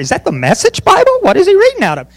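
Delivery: high-pitched mock confusion; baffled listener. Mimicking puzzled congregation members.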